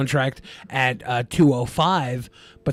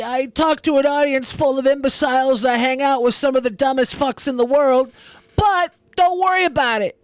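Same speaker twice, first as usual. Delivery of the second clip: much higher, prissy mocking voice; mock high-pitched smug voice; 9 semitones higher than usual. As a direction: sneer in a squeaky superior voice. Mocks Nick in a high voice.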